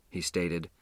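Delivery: plain reading voice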